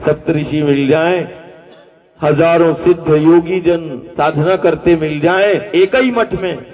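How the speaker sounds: ordinary delivery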